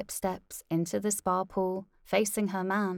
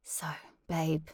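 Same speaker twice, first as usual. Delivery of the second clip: whisper; 2 semitones lower, 5 dB quieter than usual. Acted. Whispered aside to wife.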